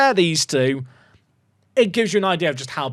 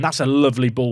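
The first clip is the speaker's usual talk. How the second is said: ordinary talk, nothing marked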